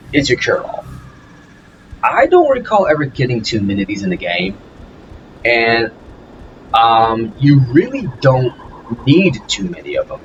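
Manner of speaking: normal talking voice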